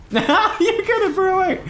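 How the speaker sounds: laughing high and slow. Real, not acted. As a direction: laugh through the words. Laughing at the play.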